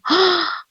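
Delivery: sharp, high gasp of dismay; imitated gasp of shock. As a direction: gasp as the bad news lands. Retelling doctor's shocked reaction.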